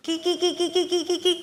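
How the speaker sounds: rapid string of short chirps; parrot call. Shown as a demonstration. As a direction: imitate a parrot's repeated call. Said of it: "തത്തമ്മ വിളിക്കുന്ന sound കേട്ടോ".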